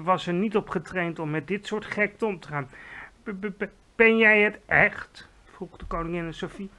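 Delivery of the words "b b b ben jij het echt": stuttering, louder disbelief; stuttered, loud question; shocked stammer. Acted.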